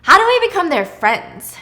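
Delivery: suddenly eager, out it comes; eager blurt. Retold or imitated. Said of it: "Thomas blurts out".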